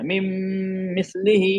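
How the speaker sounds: "मिम" held as a long nasal m; ghunna. Shown as a demonstration. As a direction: hum on the m of "मिम" before "मिस्लिही". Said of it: "इसमें गुन्ना".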